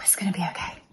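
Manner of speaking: whispered to herself; whispering. Real, not acted. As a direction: whisper it to calm yourself down. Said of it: "I'm really telling myself that".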